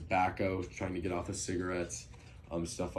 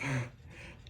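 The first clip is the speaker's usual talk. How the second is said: a quick breathy laugh; brief nervous chuckle; short laugh; 7 semitones higher, 3 dB quieter than usual. Real, not acted. Laughs at a hard phrase.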